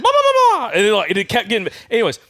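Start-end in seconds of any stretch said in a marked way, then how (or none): 0.0-0.7 longer, loud, high gobbling burst; imitated turkey gobble. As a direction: let a fuller gobble tumble out. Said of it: "And then it went".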